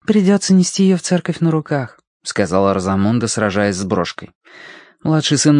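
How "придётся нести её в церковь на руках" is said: put-on higher young girl voice; girl's voice. A narrator's reading. Rosamund voiced.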